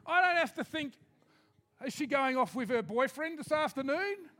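fervent preaching, rising. Acted.

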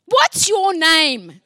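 drawn-out, loud "What's your name?" from a gruff woman; woman calling out a demand, slow and loud. Retold or imitated. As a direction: stretch "What's your name?" out slowly and loudly. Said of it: "She goes".

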